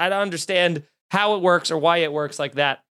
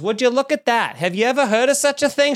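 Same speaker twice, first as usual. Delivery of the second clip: put-on higher-pitched astonished old lady; old diner woman; 6 semitones higher than usual. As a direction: imitate an elderly diner owner's catchphrase. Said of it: "it was like her catchphrase".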